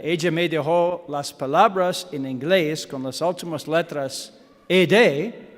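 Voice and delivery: normal talking voice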